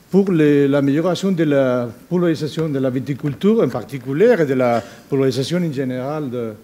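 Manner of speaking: Spanish accent in French; French spoken with a Spanish accent; foreign accent in french. Real, not acted. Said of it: "Excusez-moi, mon, mon, mon français".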